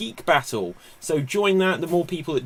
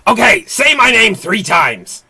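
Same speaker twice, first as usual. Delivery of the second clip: higher, louder sinister invitation; creepy villain; 7 semitones higher, 11 dB louder than usual. Acted. Host voicing a spooky summoned demon, loud sinister invite. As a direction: tempt them to summon you. Beetlejuice-style villain for guessers.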